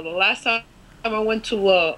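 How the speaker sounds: usual speaking voice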